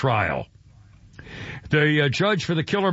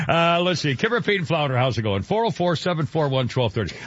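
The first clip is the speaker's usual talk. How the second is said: normal talking voice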